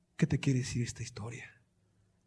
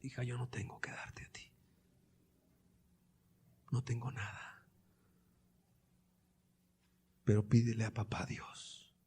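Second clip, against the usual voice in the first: imitated quiet despair; 6 dB quieter than usual. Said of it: "en una completa desesperación le dice".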